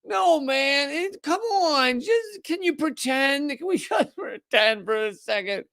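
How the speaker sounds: high, slow begging whine; mock pleading newspaper. Mocking the New York Times begging to keep pretending, high whine. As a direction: beg like a kid who won't drop it. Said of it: "still like".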